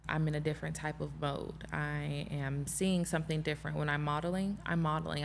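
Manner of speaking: ordinary delivery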